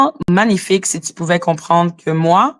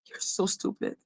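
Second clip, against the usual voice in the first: scornful whisper, far softer; whispered insult; 16 dB quieter than usual. Real, not acted. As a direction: whisper it under your breath. Mocking aside after imitation. (insulte chuchotée)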